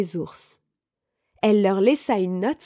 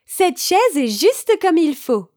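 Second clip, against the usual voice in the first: higher, slow, satisfied exclamation; exclaiming; 7 semitones higher, 4 dB louder than usual. A narrator's reading. Goldilocks sitting on the third chair, exclaiming. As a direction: exclaim it with drawn-out approval.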